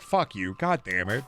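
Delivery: ordinary delivery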